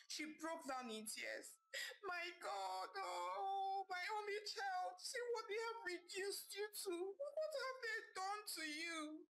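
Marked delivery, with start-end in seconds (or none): 2.1-9.3 high, sobbing cry of a mother; weeping mother. Acted. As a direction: wail over her only child in tears. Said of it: "Madam Itoha wept".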